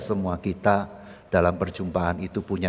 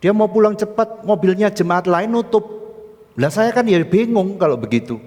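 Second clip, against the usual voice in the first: loud, high-pitched breathless-with-grievance retelling; agitated complaint; 11 semitones higher, 7 dB louder than usual. Acted. Guard recounting the blocked car incident, agitated complaint. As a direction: plead helplessness to the pastor.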